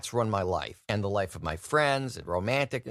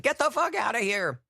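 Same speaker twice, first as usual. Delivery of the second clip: higher, half-shouted curse; mock yell at a kid on his lap; mock Santa yell; 12 semitones higher than usual. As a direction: snap it out like a fed-up Santa. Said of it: "he said".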